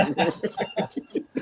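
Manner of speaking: very high-pitched giggle; real high giggle. Real, not acted. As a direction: giggle high at your own flub. Laughing at word wrong.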